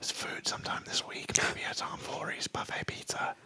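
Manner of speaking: hushed whispered invite; whispering. Pretend. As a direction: whisper it like a secret. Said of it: "Why are you whispering".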